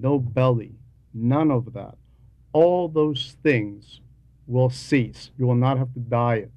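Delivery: normal talking voice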